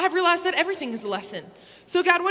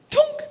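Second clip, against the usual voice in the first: imitated thud; 13 semitones higher, 4 dB louder than usual. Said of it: "she goes".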